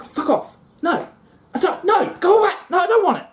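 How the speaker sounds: high, shrill go-away squeal; high, indignant female mantis voice; shrieking female mantis. Acted. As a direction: shoo him off in a squeaky panic. Female mantis rejecting the male.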